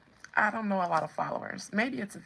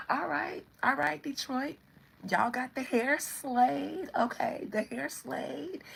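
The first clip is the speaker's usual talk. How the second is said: higher, playful, drawn-out approval; friendly acknowledgement; 6 semitones higher, slower than usual. Acted. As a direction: draw out an admiring mock shout-out. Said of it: "Everybody else just be like".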